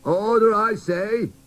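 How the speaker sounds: loud, commanding call to order; teddy bear silencing the chattering toys, loud; thundering. Acted. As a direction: bang the gavel with your voice. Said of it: "a deep voice thundered".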